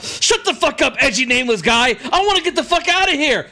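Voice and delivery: high frantic full-voiced yelling. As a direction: holler excitedly through the bars to get attention. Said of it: "you see Vex yelling".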